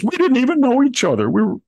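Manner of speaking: hammed-up innocent denial from the FBI director; mock straight face. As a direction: deny it with an exaggerated straight face. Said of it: "say with a straight face".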